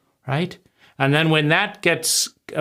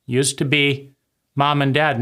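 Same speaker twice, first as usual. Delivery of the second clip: usual speaking voice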